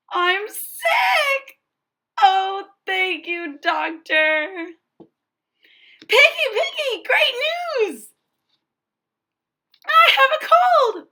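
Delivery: high, loud joy; overjoyed shouting. Acted. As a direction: shout the good news. Gerald is overjoyed to be sick.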